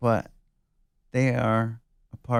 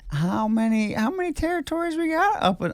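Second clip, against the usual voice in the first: incredulous; 13 semitones higher than usual. Real, not acted. Incredulous about territories.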